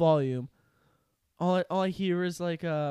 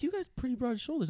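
quick, higher imitation of the girl; lightly mocking girl; 8 semitones higher, faster than usual. Retelling the classmate's compliment, quick mock girl voice. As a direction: tease it fast in a lightly girly voice. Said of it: "She's like".

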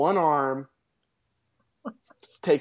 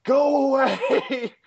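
high, loud, slow drawn-out "go away"; gruff dismissal; 11 semitones higher, 5 dB louder, much slower than usual. Retold or imitated. Old man refusing the bikers' help, loud slow and high. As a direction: shoo them off, sulky and drawn out. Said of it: "he's like".

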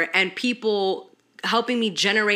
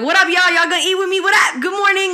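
much higher and louder, bubbly hype greeting; hyped live greeting; 12 semitones higher, 9 dB louder, faster than usual. Retold or imitated. Streamer greeting her live viewers, high loud and hyped. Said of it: "I'm eating on the live".

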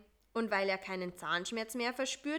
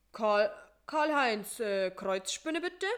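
calling out; 6 dB louder than usual. Acted. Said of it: "rief eine Zahnarzthelferin".

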